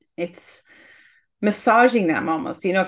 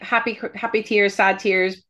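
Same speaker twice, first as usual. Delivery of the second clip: usual speaking voice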